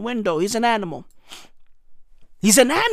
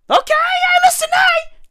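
very high, mock-squeaky disbelief; faster than usual. Comedian mocking the minister, squeaky disbelief. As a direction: squeal it in fake surprise.

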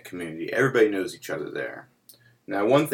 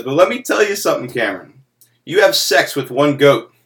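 laughing punchline; 7 semitones higher, 10 dB louder than usual. Retold or imitated. Uncle John's punchline, cracking up.

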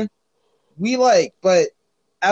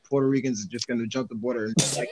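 ordinary delivery

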